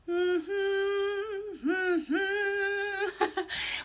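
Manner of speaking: high hummed tune; sustained singsong humming; melodic hum. Shown as a demonstration. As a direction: hum a little tune as a clue. Hums a tune as a clue.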